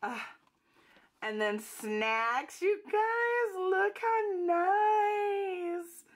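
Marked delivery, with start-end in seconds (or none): 2.6-6.0 very high, drawn-out gushing; delighted squeal. Real, not acted. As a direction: squeal slowly to show it off. Showing viewers the gift, delighted.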